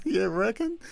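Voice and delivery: high-pitched scoffing retort with laughter behind it; mock disbelief. Host answering people who call Australians tough, mock disbelief. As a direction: squeak it out as an amused, doubting comeback.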